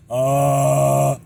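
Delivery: rattling voice cracking into air; vibrating false-chord distortion. Shown as a demonstration. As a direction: sound like talking through a fan. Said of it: "And holding that note".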